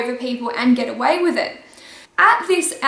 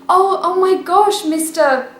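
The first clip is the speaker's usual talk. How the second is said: fake shock; 5 semitones higher than usual. Feigning shock after pancaking teacher.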